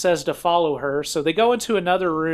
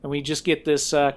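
ordinary talk

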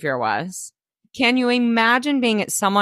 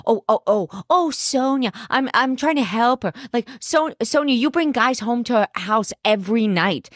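mocking Bethany; 4 semitones higher than usual. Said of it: "Bethany's like".

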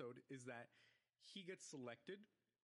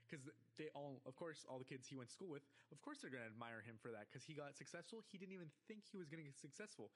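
ordinary talk, nothing marked

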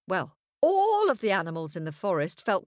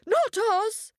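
much higher tiny-creature voice; squeaky innocent; 14 semitones higher, 3 dB louder than usual. A narrator's reading. Animals deny.

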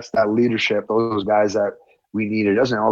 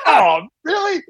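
squealed, much higher mock disbelief while laughing; mock surprise; 3 dB louder than usual. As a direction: play up the fake shock through a laugh. Mock-surprised reply to a teammate.

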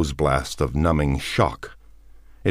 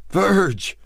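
high, shaky call of a name; weak, shaky cry; 4 dB louder than usual. A narrator's reading. Man calls into the darkness, shaky cry. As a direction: call out again, shaken. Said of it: "weakly, shakily".

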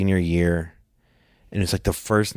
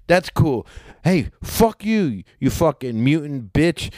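high-pitched, slowed-down cursing; mock-heated, drawn-out insult; retold angry insult; 6 semitones higher, slower than usual. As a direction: act out cussing someone off. Friend insults golfer in retold quarrel.

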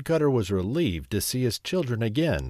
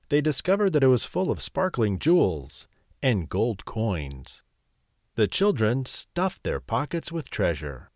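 ordinary delivery

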